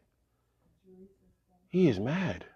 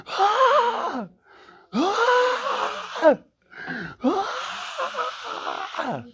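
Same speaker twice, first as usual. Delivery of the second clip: high, loud full-throated scream of shock; screaming at top of lungs; 5 dB louder, much slower than usual. Acted. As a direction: scream the wake-up horror, three times. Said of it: "he is screaming at the top of his lungs".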